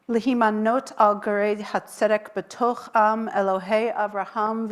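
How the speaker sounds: solemn, measured prayer chant in Hebrew; blessing recitation. Acted. Rabbi blessing the new convert, solemn prayer voice. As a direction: intone the blessing over the congregation.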